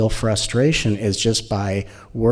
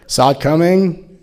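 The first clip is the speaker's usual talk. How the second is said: preen like a self-satisfied cleverclogs; mock clever boast; smug mock voice; 5 semitones higher than usual. Mocking smug self-congratulation.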